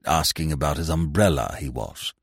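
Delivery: coolly disapproving servant; touch of coldness. A narrator's reading. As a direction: pointedly report it, low and cool. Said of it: "with a touch of coldness".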